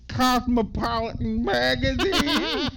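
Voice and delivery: high, loud, drawn-out exaggerated impression; Jennifer Coolidge. Podcaster imitating the actress ridiculously interjecting, high loud caricature. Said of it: "that's what Jennifer Coolidge sounds".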